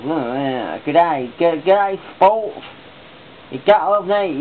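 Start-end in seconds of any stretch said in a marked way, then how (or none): 0.9-2.6 hammed-up fake Australian, pitched high; a cheesy Aussie G'day, sung up high; over-the-top mock Australian greeting; bad Australian accent. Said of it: "bad Australian accent on". 3.5-4.4 hammy Australian twang, cut off mid-word; bad Australian accent. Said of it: "bad Australian accent on".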